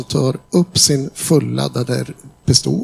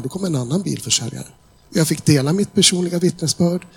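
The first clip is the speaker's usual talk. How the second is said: ordinary talk, nothing marked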